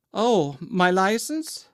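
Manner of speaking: put-on high, slow Southern accent, feigning innocence; innocent Southern belle. A narrator's reading. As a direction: play dumb sweetly in a high Southern voice. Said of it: "slightly affectionate Southern accent".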